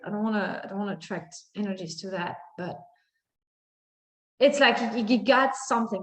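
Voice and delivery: ordinary talk, nothing marked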